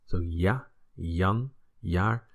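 ja, Jan, jaar starting with a "y" glide. Shown as a demonstration. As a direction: say ja, Jan, jaar as "ya, yan, yaar". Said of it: "like the Y at the".

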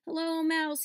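loud, high call over the racket; shouting. A narrator's reading. Cecil calls over the mice's racket, shouting. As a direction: call out over the noisy mice. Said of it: "he shouted".

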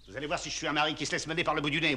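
ordinary delivery

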